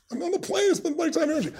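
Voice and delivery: retold exasperation. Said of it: "instead of being pissed".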